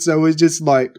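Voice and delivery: ordinary talk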